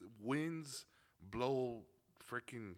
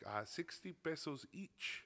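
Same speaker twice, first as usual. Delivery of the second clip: higher, slowly spelling out the price; slow girl vendor; 7 semitones higher, slower than usual. Retold or imitated. Girl vendor quotes the price slowly and higher. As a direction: drag the price out, a bit higher. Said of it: "she's like".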